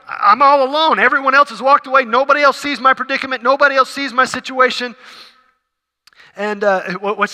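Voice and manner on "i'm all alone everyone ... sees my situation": high, loud, rushed protest of loneliness; distressed complaint. Acted. Voicing a listener who feels forsaken, high urgent complaint. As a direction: let the complaints tumble out high and fast.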